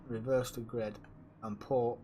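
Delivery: ordinary talk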